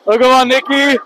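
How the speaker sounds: ordinary talk, nothing marked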